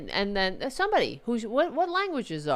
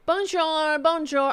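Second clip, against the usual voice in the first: loud, high sarcastic greeting; mocking show-off; mock French speaker; 7 semitones higher, 6 dB louder than usual. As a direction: belt out a hammed-up French hello. Parodying claimed French fluency.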